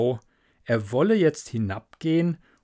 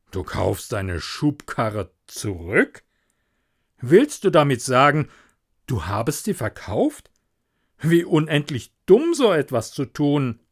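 disapproving miller; 4 dB louder than usual. Acted. Miller questioning Hans about the sold barrow, disapproving.

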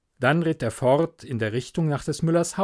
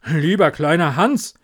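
loud, slightly higher call at the door; 3 semitones higher, 6 dB louder than usual. Acted. Miller calling to Hans at his door, loud. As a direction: call his name through the storm. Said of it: "rief der Müller".

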